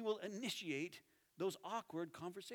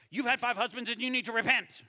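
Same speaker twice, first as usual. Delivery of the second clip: loud confrontational jab; blunt accusing outburst; mock harsh accusation; 4 semitones higher, 12 dB louder, faster than usual. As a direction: hurl it like an attack. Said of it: "He doesn't go".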